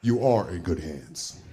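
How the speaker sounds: low, slow ad pitchman voice; Allstate spokesman. Acted. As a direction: deliver a deep, measured insurance slogan. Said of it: "I sound like a dude from Allstate".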